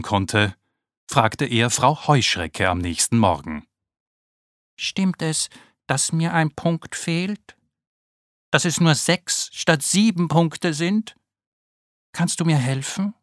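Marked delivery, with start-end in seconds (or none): 4.8-13.1 higher, timid little ladybird voice; a worried small beetle asking for help; small ladybird Naoki. Acted. Naoki anxiously asking Frau Heuschrecke about his spots, timid and high.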